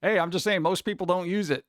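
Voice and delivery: usual speaking voice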